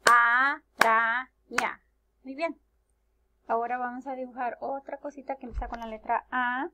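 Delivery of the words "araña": araña as a - ra - ña, slow and loud; araña broken into three drawn-out syllables, first one loudest. Shown as a demonstration. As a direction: clap out araña in three beats. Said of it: "Tiene tres sílabas".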